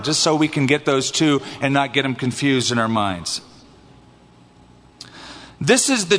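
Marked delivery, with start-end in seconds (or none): none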